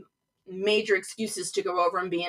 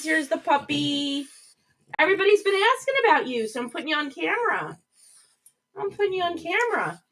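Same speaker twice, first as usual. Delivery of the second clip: high, sing-song voice for the puppy; dog talk; 9 semitones higher than usual. Real, not acted. Baby talk to her dog.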